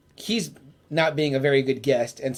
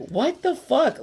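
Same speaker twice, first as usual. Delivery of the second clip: high-pitched, baffled reaction; mock disbelief; 9 semitones higher, slower than usual. Host voicing viewers shocked by the villain, mock disbelief.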